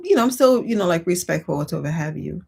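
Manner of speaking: usual speaking voice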